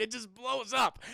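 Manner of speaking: normal talking voice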